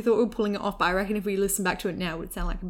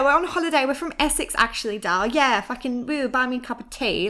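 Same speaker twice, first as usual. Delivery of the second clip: higher, louder hammy Essex voice; fake Essex accent; 5 semitones higher, 3 dB louder than usual. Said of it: "shocking accents there".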